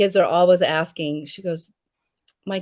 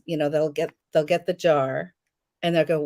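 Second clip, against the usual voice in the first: ordinary delivery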